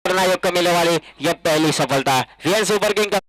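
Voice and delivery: loud, rising wicket call; excited cricket commentary. Real, not acted. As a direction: shout the wicket out.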